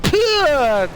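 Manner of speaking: loud, high imitated spit; explosive falling "ptew" noise; spitting out. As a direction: spit a blade out forcefully. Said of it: "I spit it out, I'm like".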